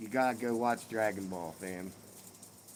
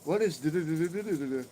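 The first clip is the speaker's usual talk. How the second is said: put-on quick, higher-pitched kid's riddle with babbled filler; imitating daughter; 6 semitones higher, 4 dB louder, faster than usual. Daughter springs a dad joke on her father, imitated quick babble. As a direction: rattle off the joke in nonsense syllables. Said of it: "And she'll go".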